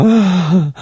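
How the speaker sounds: high, swooning "ah"; lovestruck sigh. Acted. Someone newly in love gazing at a partner, swooning sigh. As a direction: melt into a high sigh of adoration. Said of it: "You just go".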